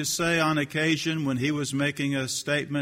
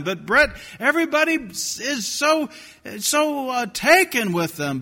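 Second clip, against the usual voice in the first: whiny higher protests growing louder; imitating a pleading listener who won't accept it; mock objector; 9 semitones higher, 8 dB louder than usual. Imitated listener protesting to Brett, mock whine.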